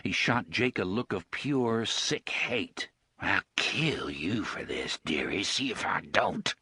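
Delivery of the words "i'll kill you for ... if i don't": put-on the thug threatens the boy; low threat. Gasher threatens Jake.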